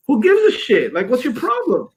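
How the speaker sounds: high-pitched indignant comeback; irritated retort at normal volume; retold confrontation. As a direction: throw it back at him, pitch jumping up.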